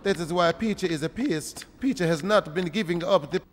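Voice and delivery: put-on Mexican accent, measured and stilted; hammed-up Mexican accent; mock Mexican accent. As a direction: grumble along in a fake Mexican accent. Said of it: "Mexican, uh, a-, accent".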